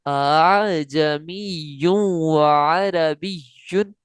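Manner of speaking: अअजमियुन व अरबियुन slow, voice waving on the softened hamza; tasheel recitation. Shown as a demonstration. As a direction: let the voice ripple between hamzas in अअजमियुन. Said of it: "कैसे?". (तसहील के साथ लहराती आवाज़ में)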